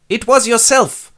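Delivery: high, loud blurted reveal; childish seer. Acted. Tiresias blurting out the truth to Oedipus, high and loud. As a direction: blurt it out like a sulking kid.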